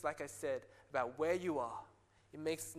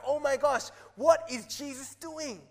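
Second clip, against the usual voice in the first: high, loud gasp of disbelief; staged astonishment; shocked crowd; 8 semitones higher, 9 dB louder than usual. Retold or imitated. Crowd shocked at Jesus touching leper.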